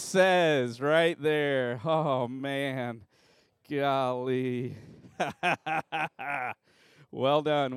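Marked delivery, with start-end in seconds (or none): none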